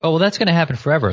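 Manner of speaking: ordinary talk, nothing marked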